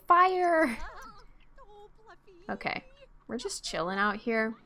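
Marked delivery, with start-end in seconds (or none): 0.1-0.8 higher, raised mock shout; playful yell. Player pretends to yell fire at her, playful yell. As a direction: call it out like a mock warning. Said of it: "Can we yell at her and say".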